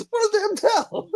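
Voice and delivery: very high, pressed coach voice; Duke impression. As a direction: squeal it like a frantic trainer. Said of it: "all I'm saying is".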